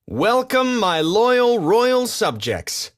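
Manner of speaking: high, loud and slow; pompous king greeting; grand royal welcome. Acted. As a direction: call out like a vain king to his court. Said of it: "he called to the animals".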